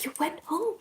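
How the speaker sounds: high, whispery question; breathy, rising disbelief; hushed amazement. Retold or imitated. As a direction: imitate a frail old man's soft wonder. Retold frail man's delighted reaction.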